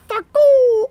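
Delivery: very high loud shriek; high squeal. Retold or imitated. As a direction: screech it like a child. Repeating the creature's curse.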